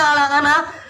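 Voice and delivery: plain reading voice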